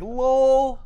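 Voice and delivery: high, loud mocking lol; mocking Hulk. Hulk mocking the Challenger, smug. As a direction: toss it off mockingly.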